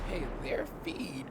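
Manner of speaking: ordinary delivery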